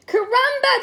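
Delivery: worried friends calling out. Acted. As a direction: call down to him from the sky. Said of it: "they called".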